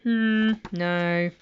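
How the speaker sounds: usual speaking voice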